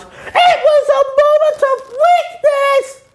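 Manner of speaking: high, mock tearful cartoon cry; mock sobbing SpongeBob. SpongeBob sobbing to spatula, high mock wail. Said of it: "And then he sobs".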